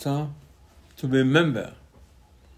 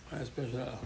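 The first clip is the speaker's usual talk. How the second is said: Very special halacha whispered back much softer; quiet echo; 13 dB quieter, much faster than usual. Shown as a demonstration. Rabbi repeating the point to himself, much softer. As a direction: let it fall away under the breath.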